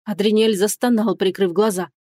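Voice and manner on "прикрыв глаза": ordinary delivery, nothing marked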